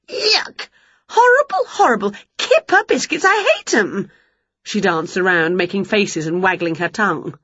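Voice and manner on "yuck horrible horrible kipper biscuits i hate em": high-pitched revulsion; disgusted outburst. Acted. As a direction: exclaim high, as if the taste is foul. Winnie spits out a hated biscuit.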